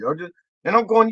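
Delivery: ordinary talk, nothing marked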